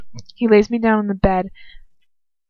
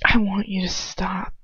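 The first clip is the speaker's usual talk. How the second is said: slow pleading whisper; desperate whisper; 4 dB quieter than usual. Acted. Heroine pleading with him, whispered. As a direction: whisper it, begging him to stop. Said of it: "I say desperately".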